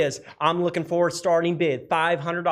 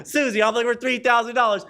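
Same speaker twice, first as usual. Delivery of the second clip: normal talking voice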